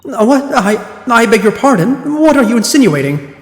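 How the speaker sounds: a respectable wife clutching her pearls; accused wife protesting to the court, indignant; prim offended lady. Acted. Voices Mrs Geradeb in court.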